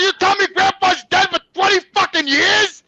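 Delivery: high, loud, near-wailing shout; retold incredulous yell; outraged accusation. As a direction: act out confronting mother at full pitch. Retold yelling at mother.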